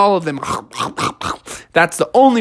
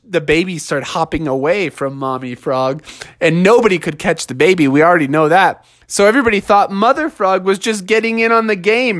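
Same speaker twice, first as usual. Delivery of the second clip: usual speaking voice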